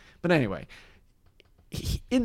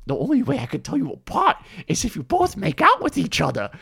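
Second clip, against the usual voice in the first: goofy put-on comedian voice; mock-innocent suggestion in a high comic voice; pitched-up movie-star impression; Adam Sandler; 5 semitones higher, faster than usual. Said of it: "Adam Sandler's just like".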